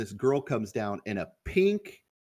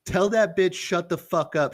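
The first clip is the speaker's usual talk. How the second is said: retold yell; 7 semitones higher, 3 dB louder than usual. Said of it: "this guy just yells".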